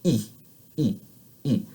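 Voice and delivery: short steady "E", spread lips; tense French ee. Shown as a demonstration. Teacher modelling for students, tense unchanging "E". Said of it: "your tongue and throat needs to be relatively tense".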